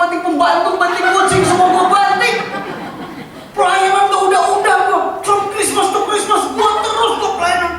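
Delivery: preacher mocking a churchgoer angry at his church; mock indignant rant. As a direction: ham up the offended servant's outrage. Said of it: "Aneh, Pak, kalau sakit hati".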